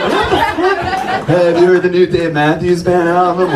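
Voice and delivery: imitating the shell-necklace teammate, mocking; mock preppy guy. Teller mocks a preppy teammate, dumbfounded then chirpy.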